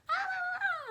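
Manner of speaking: loud, very high wordless mimicking wail; high-pitched mocking "ah-ah-ah"; mock shouting. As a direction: imitate a shouting teacher as shrieked nonsense. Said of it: "started shouting at us".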